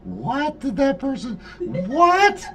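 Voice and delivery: high, incredulous squeak of confusion; baffled disbelief. Retold or imitated. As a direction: sound utterly baffled by what you heard. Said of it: "I'm going".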